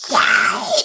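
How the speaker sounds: overjoyed whooping yell; gleeful cheering. Acted. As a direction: cheer it out at full volume. Celebrates the neighbour's plan to move.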